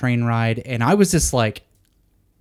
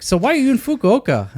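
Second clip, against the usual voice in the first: much higher, lightly voiced old man; chirpy curious old driver; pitched-up old taxi driver question; old cabbie asking; 12 semitones higher, 3 dB louder than usual. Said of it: "he's like".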